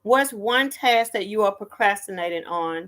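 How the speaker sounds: ordinary talk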